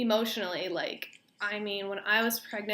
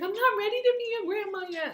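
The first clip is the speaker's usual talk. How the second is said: high, louder voice as her upset mom; mom's reply; 13 semitones higher, 5 dB louder than usual. Retold or imitated. Mom in the car hearing the news, high voice. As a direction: play mom protesting through tears, pitched high.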